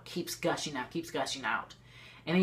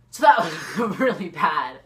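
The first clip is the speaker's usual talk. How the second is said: laughing; 9 dB louder, slower than usual. Real, not acted. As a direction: laugh out loud, slowing down. Laughing at the memory.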